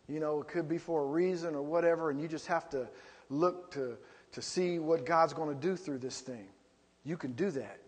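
soft, gentle consoling; comforting friend. Acted. Friend consoling someone feeling down, softly reassuring. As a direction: ease a grieving friend.

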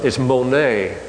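ordinary delivery

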